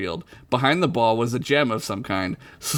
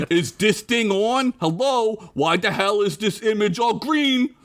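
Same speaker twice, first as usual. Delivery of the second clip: raised-pitch, hammed-up Italian accent; 6 semitones higher than usual. Hologram fussing over a bad image, Italian accent. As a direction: complain in a put-on Italian accent. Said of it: "I gotta do an Italian accent now".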